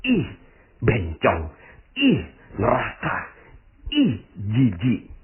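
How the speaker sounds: mock disgust, each ih pushed out with contempt; imitating a scornful onlooker; disgusted sneer. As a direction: sneer it like a judgmental passer-by. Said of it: "ngomongnya gini".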